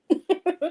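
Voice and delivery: short high laugh; real laugh. Real, not acted. Laughs after quote.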